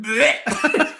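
high loud gagging bleh; disgusted bleh. Retold or imitated. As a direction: retch in mock disgust. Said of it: "And she went".

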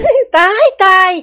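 very high, long drawn-out outcry; amused exclamation. A narrator's reading. Women react to the embarrassing accident, amused high wail. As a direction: stretch each word high in amused horror. Women laughing without restraint at confession.

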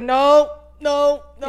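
high, loud repeated protest; retold emotional protest. Daughter trying not to cry, loud high protest. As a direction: imitate rising protest, pushed up loud. Retold fighting back tears.

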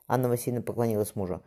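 ordinary delivery, nothing marked

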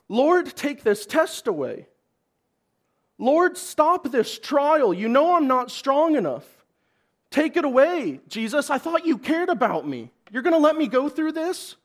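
hammed-up frightened begging to God; complaining prayer. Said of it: "There is no".

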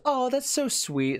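high, slow cooing lady voice; gushing dog owner. Acted. Lady watching mailman pet her dog, gushing. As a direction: put on a charmed lady's coo.

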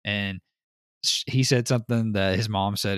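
ordinary talk, nothing marked

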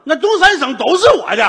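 boastful. Acted. 张作霖得意.

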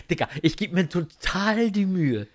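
ordinary talk, nothing marked

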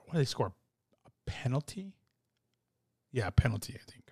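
hushed, unsure whisper to himself; whispered aside. Real, not acted. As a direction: whisper it like checking the facts quietly. Unsure aside about Tottenham's goal.

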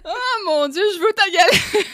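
high, loud giddy plea; too much caffeine. Real, not acted. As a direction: beg with squealing delight. Gushing, begging for galette.

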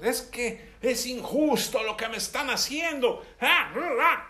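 high-pitched acted protest ending in growls; mock outraged reaction. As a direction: act out an indignant outburst. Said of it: "¿Cómo reaccionamos?".

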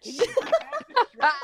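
high, loud, laughing repeat of the request; exasperated shout. Retold or imitated. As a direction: yell it the third time, cracking up. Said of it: "And I'm like".

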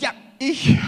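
loud, high eager "Ja, ich!"; eager volunteer. Acted. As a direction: shout out your hand-up like a keen volunteer.

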